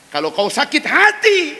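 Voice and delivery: high, loud preacher's cry; sudden loud, high burst of warning; fiery warning shout. Real, not acted. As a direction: hit it hard as a warning.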